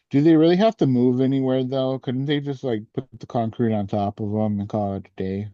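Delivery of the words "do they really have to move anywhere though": ordinary delivery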